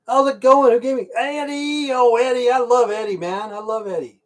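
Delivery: high, loud happy greeting; excited greeting. Real, not acted. Greeting a chat viewer excitedly.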